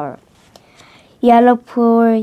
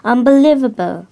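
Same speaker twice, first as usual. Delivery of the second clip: quick, louder burst of amazement; amazed exclamation; 3 semitones higher, 6 dB louder, faster than usual. A narrator's reading. Yellow amazed by friend's coloring, exclaiming. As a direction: exclaim it in wonder.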